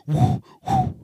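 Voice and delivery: high whooshing whoo imitating a moth; mock moth buzz. Imitating a moth's loud buzz for listeners. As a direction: make the moth's high buzzing sound.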